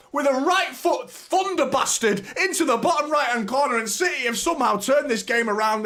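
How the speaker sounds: very high, full-force commentary shout; ecstatic goal scream. Real, not acted. Commentator calling Gvardiol's first City goal, ecstatic screaming. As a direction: scream the goal home in disbelief.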